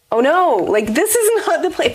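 high-pitched startled cry of dismay; retold alarm, pitched well up; dismayed shock. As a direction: squeak it out as the plan collapses. Dismay at unexpected pregnancy news.

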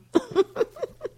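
real high-pitched laugh at her own joke; high burst of laughter. Real, not acted. Host cracking up at her own joke, real high laugh. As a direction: let a high giggle burst out. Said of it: "This is hilarious".

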